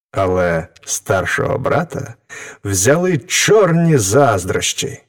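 ordinary delivery, nothing marked

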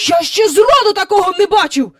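high, loud indignant tirade; shouting threats. A narrator's reading. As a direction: yell threats of complaint at the sky. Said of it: "викрикував свої погрози в небо".

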